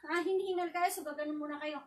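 ordinary talk

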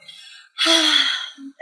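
a big, weary, high-pitched mock sigh; imitated giant sigh. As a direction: let out a long, put-on heavy sigh. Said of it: "He's like".

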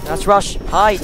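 loud, high urgent warning; urgent shouting. Real, not acted. Monster warning lights flicker.

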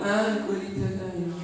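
mock glum indifference; joyless echo. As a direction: repeat the news with no joy at all. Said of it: "Ele não alegra-se com nada".

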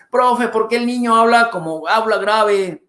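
lightly voiced complaining viewer; mock viewer complaint. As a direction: whine it as the picky viewer. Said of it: "me dicen".

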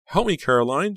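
high, loud plea for help; acted panic, pitch way up; desperate cry. A narrator's reading. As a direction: cry out to a friend for rescue. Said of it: "she cried".

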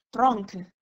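loud, mildly agitated call. A narrator's reading. Lieutenant calling his subordinate nearby, agitated. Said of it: "chiamò Drogo in tono agitato".

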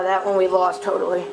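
normal talking voice

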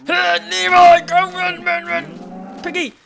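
high panicked yelling; being chased. Real, not acted. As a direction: scream while fleeing danger. Fleeing, pig lost.

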